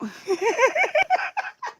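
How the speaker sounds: high squealing laugh; a rising high laugh; high delighted laugh. Real, not acted. Laughs at Charlie sniffing.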